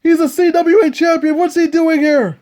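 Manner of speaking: ordinary talk, nothing marked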